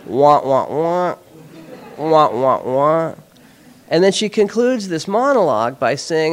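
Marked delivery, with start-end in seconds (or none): 0.0-3.1 muffled wah wah talk; droning trombone-like wah wahs; Charlie Brown teacher voice. Retold or imitated. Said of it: "sounds like the teacher in a Charlie Brown cartoon".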